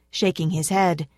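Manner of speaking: usual speaking voice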